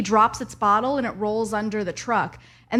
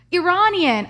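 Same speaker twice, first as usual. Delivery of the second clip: correcting himself; 8 semitones higher, 4 dB louder, slower than usual. Retold or imitated. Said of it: "I was like".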